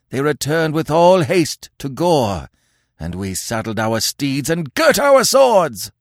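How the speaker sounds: sustained high, loud tirade; hateful, accusing yell. Acted. Warriors accuse Conan of betraying them, yelling with hate. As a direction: pour out the charge at full pitch. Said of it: "A yell of hate and accusation".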